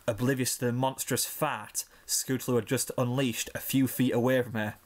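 ordinary delivery, nothing marked

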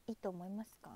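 soft unvoiced whisper; whispered question. Real, not acted. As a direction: hush it like a secret. Asking viewers' opinion softly.

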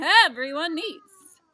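full-throated slow furious shout; mad shout. Acted. Onceler raging at the Lorax, slow furious bellow. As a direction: bellow it, dragging the words out. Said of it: "I got terribly mad".

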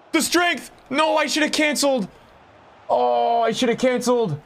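high, pained groaning complaint; frustrated outburst. Real, not acted. Streamer regretting not cancelling the move, frustrated.

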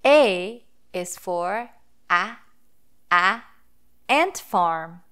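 slow, "ant" stretched in "ant farm"; sounding out A. Shown as a demonstration. Teacher sounding out "ant farm" for children. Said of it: "the sounds that the vowel letter A makes".

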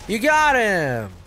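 loud, slow, drawn-out cheer. Real, not acted. Gamer cheers his teammate D R L's kill. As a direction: celebrate the kill, stretching it.

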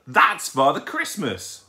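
loud, high, drawn-out exclamation; shrieked. A narrator's reading. Owlet recognising Father Christmas, excited shriek. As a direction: announce it slowly in excited amazement. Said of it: "shrieked Plop".

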